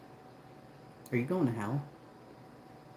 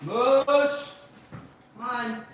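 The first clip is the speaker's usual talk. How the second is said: high, carrying dog call; high-pitched summons; 11 semitones higher, much slower than usual. Real, not acted. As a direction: call them in with a high, stretched voice. Calling dogs in.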